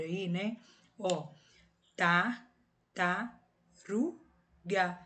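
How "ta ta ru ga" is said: "ta, ta, ru, ga" as four separate beats; each syllable of "ta, ta, ru, ga" alone, with pauses. Shown as a demonstration. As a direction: count out ta... ta... ru... ga. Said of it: "Tenho quatro sílabas".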